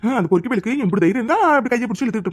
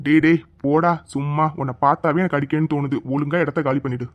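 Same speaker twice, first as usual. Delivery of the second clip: stern hero; 7 semitones lower than usual. Acted. Hero dismisses the thug. (கதாநாயகன் கடுமையான கட்டளை)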